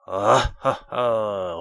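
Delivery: big, loud mocking royal laugh; roaring laugh. A narrator's reading. Tyrant king roaring with laughter at the dwarf. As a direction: roar out a cruel laugh. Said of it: "rugit ce dernier".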